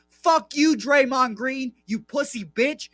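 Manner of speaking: front-row kid heckling Draymond, high mock yell; mock kid heckle. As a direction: jeer it like a brat in the front row. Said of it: "a twelve-year-old child saying".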